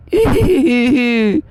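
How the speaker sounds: high hammed-up fake sob, loud; a silly fake boo-hoo; mock crying wail. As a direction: pretend to burst into tears, badly faked. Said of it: "pretend like I'm crying".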